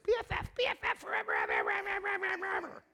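high mocking sing-song gush; mock gushing teen. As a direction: tease the gushing best friends. Mocking two friends' Facebook posts.

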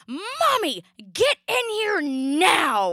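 high, loud, slow demanding shout; toddler scream. Retold or imitated. Mother imitating her toddler's high, slow demanding shout. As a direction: bellow it like a bossy toddler. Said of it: "she screamed".

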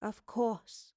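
soft, sad mutter; muttered sadly. A narrator's reading. Professor to herself as keys are given away, muttering sadly. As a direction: mutter it sadly under your breath. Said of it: "muttered sadly under her breath".

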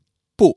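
不 with a sharp falling tone; fourth tone. Shown as a demonstration. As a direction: say 不 loud, dropping from high to low. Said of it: "is in the fourth tone".

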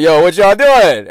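loud, drawn-out cop call; imitated cop challenge. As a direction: call out like a cop confronting loiterers. Acts out cops calling out to them.

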